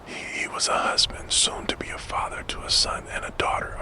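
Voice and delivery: ordinary delivery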